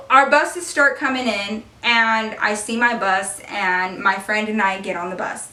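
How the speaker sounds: normal talking voice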